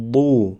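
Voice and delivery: بو with a rounded u vowel; syllable demo. Shown as a demonstration. Teacher models بو with damma. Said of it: "دم داشته باشد".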